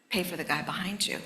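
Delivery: soft, hushed voice of God; quiet whisper of a divine nudge; inner voice. Retold or imitated. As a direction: say it like a quiet prompting inside you. Inner voice a fourth time.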